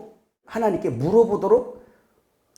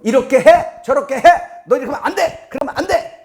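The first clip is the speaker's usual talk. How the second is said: put-on loud, high barked orders to a child; bossy parent commands; 9 semitones higher, 7 dB louder than usual. Parent yelling rules at the children, high and loud. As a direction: snap orders like a nagging parent.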